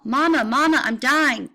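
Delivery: loud, high howl of Mama, I'm dying; howling for mother. A narrator's reading. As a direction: cry it out like a wounded child calling home. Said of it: "I howl".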